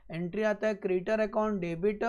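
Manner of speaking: usual speaking voice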